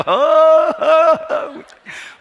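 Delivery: loud high burst of laughter; elder laughing at the pastor's complaint, loud and high; big laugh. Retold or imitated. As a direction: let out a hearty hooting laugh.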